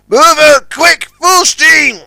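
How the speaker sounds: loud, high urgent shout. Acted. Big Mac shouts orders to trapped Sunshine. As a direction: bellow the order. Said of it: "shouted Big Mac".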